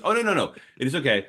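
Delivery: high, quick reassuring no no no; young woman reassuring. Retold or imitated. Young woman at the table quickly reassures, high-pitched. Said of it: "And she goes".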